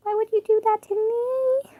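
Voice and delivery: high silly voice; high squeaky voice. Shown as a demonstration. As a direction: squeak out "Why would you do that to me?" like a cartoon. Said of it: "same line four ways".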